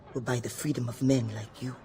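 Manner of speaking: ordinary delivery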